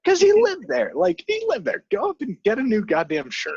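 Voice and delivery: he lived there, just go get a shirt; indignant outburst. Real, not acted. Friend arguing Jake could just change shirts, exasperated outburst.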